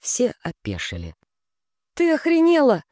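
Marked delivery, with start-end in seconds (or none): 1.9-2.8 high, loud outraged cry; shocked shout. Acted. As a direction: yell it in disbelief. Outraged reaction.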